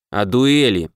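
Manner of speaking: ordinary delivery, nothing marked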